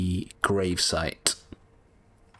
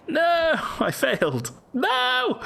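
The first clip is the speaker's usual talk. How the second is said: frustrated cry; much slower than usual. Real, not acted. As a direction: yelp out the failure. Failed move in game.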